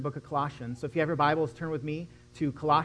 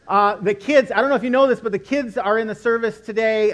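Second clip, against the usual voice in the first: excited; 6 semitones higher, 9 dB louder than usual. Real, not acted. Pastor announcing kids in service.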